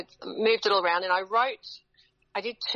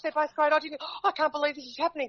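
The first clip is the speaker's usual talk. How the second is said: retold overwhelmed friend; 8 semitones higher than usual. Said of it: "He goes".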